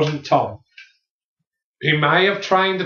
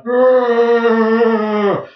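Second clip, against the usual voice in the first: loud high drawn-out arr; a big moaning yawn; mock yawn groan; 9 semitones higher, 5 dB louder than usual. Retelling Dave's yawn as a loud arr. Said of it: "He just went".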